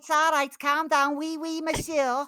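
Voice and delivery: high, loud mock French-Scouse accent; French Scouser. Asked about their accent, comic French-Scouse mix. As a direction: blend Scouse patter with cartoon French, pitched high. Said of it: "what's, is your accent".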